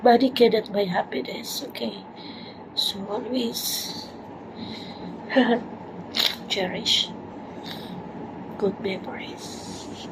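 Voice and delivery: soft tender whisper. Real, not acted. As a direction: hush it gently.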